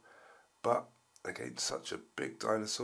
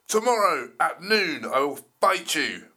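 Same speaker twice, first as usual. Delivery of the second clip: loud roaring dinosaur voice; angry T-Rex roar; 6 semitones higher, 11 dB louder than usual. Acted. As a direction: bellow like a furious T-Rex. T-Rex threat.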